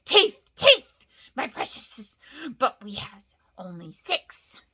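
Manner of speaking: shrill loud burst, then a slow hush; gloating creature, then sly; Gollum's creature voice. Acted. Gollum answers Bilbo's riddle, shrill then hushed.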